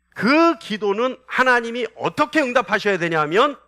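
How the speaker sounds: ordinary delivery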